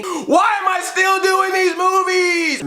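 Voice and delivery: high, slow, whiny shout; slow high hammed-up despair; mock despairing writer. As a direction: wail it like a worn-out writer. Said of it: "this writer that's like".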